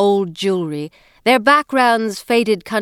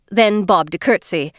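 ordinary delivery, nothing marked

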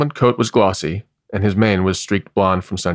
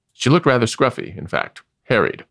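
ordinary delivery

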